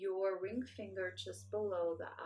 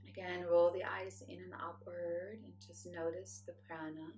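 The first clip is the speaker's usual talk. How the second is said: guided meditation; 5 dB quieter than usual. Acted. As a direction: guide them inward, calm and hushed. Leads a meditation.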